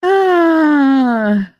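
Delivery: sudden high loud "ah"; sharp shout. Real, not acted. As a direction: blurt it out, startled. Sudden outburst on stream.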